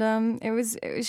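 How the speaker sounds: normal talking voice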